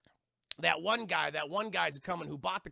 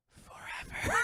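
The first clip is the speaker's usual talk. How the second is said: voiceless, drawn-out menace; mock-evil voiceless croak; sinister villain; slower than usual. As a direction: rasp it out as a creepy punchline.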